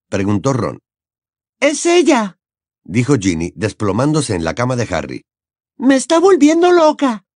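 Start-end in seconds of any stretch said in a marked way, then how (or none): 1.6-2.4 put-on high, fed-up teenage girl; grumbling younger sister; Ginny. Ginny complaining. 5.8-7.2 put-on high, fed-up teenage girl; grumbling younger sister; Ginny. Ginny complaining.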